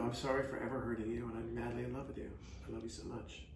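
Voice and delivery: mean every word, gently; tender confession of love to his wife; sincere apology. Pretend. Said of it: "meant to do that seriously".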